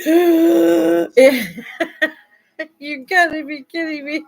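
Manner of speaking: high, loud mock shock; retold disbelief. Seeing herself on TV, a loud, high mock cry of disbelief. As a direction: cry out in disbelief at her own face.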